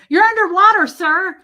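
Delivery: loud, high phone holler; shouted warning. Retold or imitated. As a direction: yell to a caller underwater. Telling caller phone is underwater.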